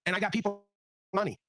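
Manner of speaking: normal talking voice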